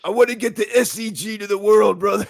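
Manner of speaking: high, loud raspy wrestler growl ending on "brother"; Macho Man Randy Savage. Pretend. Imagining the philosopher as a wrestler in a loud wrestler promo voice. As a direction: cut a wrestling promo, full of swagger. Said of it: "I wish he talked like a wrestler".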